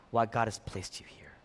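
breathy hushed whisper to the congregation. Real, not acted. Pastor whispers to his friends why God placed them here. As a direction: let the words fall to a whisper, intimate. Preacher drops to a hushed aside.

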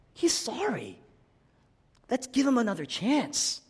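high-pitched imitation of begging for someone; pleading. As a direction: plead with the judge for mercy. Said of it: "Jesus would plead his case".

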